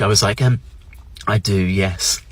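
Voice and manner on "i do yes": soft, low, very British reply; exaggerated British reserve, low and quiet; hushed, polite British understatement; even more British. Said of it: "I actually went even more British".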